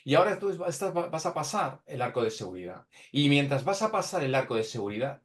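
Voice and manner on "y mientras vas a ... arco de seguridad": ordinary talk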